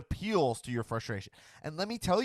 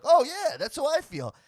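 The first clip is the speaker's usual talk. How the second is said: sudden high, loud "oh yeah", caught up in it; gullible agreement; 12 semitones higher, 4 dB louder than usual. Retold or imitated. Host imitates a frustrated viewer won over, high and eager. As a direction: play the viewer who just got hooked by the rant. Mimicking a swayed angry viewer.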